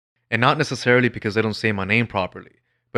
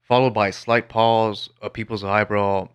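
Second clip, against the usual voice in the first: usual speaking voice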